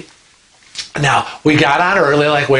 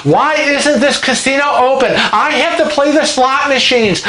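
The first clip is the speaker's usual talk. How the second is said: mock tantrum; 9 semitones higher than usual. Casino-hungry lady having a fit over the closed casino, high mock shriek. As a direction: ham up a passenger's meltdown. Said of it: "she's screaming and having a fit".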